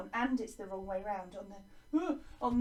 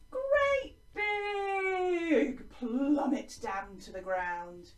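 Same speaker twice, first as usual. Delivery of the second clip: plummeting voice; 4 semitones higher, 5 dB louder, much slower than usual. Shown as a demonstration. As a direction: start high and loud, slide slowly down. Acts out a plummeting line.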